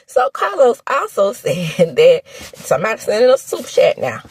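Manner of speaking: normal talking voice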